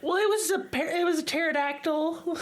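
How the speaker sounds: high voice, about to crack up; barely holding in the giggles; fighting laughter. Real, not acted. Cracking up at own story.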